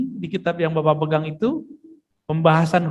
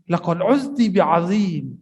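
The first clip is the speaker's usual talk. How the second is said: solemn Arabic quote; slower than usual. Retold or imitated. Prophet's reply quoted in Arabic.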